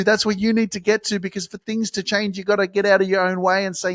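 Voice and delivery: usual speaking voice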